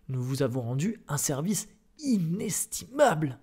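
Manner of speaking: high, slow, stretched emphasis; grandiose overselling. A narrator's reading. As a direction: sell the favour grandly, drawing it out. Director overselling the favour. (emphase grandiose et lente)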